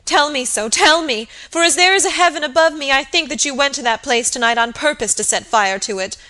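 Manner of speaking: high, loud, agitated voice; anguished pleading. A narrator's reading. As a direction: beg desperately to be told you're wrong. Kneeling maid accuses and begs.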